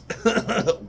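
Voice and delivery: a quick high amused laugh; high burst of laughter. Real, not acted. Reviewer cracking up at his own tasting note, high laugh. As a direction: laugh at your own odd phrase.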